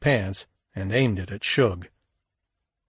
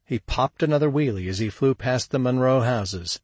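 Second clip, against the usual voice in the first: usual speaking voice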